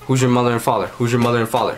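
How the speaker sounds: ordinary talk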